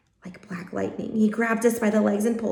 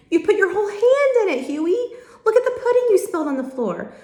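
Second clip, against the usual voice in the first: excited scolding; 11 semitones higher, 5 dB louder than usual. Acted. Julian scolds Huey over spill.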